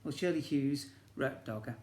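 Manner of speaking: usual speaking voice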